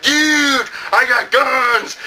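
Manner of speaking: slow high dude voice; nineties kid. Retold or imitated. Nineties kid bragging about guns, slow. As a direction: stretch it out like a dumb dude. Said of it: "the nineties kid is all like".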